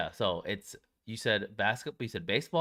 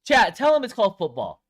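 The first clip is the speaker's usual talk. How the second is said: raised, emphatic call to the chat; louder and higher, telling the chat off; loud insistence; 8 semitones higher, 9 dB louder than usual. Real, not acted. Friend argues football naming, loud insistence.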